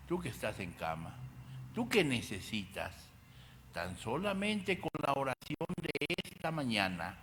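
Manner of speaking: ordinary talk, nothing marked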